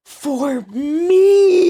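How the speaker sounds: high, drawn-out squeal of delight; overjoyed squeal. Retold or imitated. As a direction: re-enact the thrill with a loud, rising cry. Retold ecstatic reaction to DLC.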